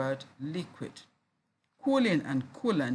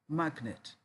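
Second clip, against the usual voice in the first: ordinary delivery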